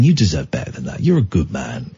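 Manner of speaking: low, quiet reassurance; softened tone. Retold or imitated. Driver reassuring an upset stranger, softened tone. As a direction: gently build him up. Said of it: "then I softened my tone".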